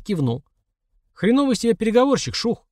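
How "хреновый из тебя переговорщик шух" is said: much higher pitched, mild yell; called out. A narrator's reading. Tyoma mocking the negotiator from inside the car, calling out. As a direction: jeer it across to a friend. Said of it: "крикнул Тёма из салона".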